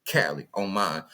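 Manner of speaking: relaxed Cali slang voice; his old Cali voice. Shown as a demonstration. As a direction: drop into the old California way of talking. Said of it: "I ain't talked like this before I moved to the South".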